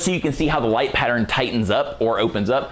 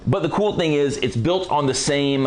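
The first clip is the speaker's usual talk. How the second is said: ordinary delivery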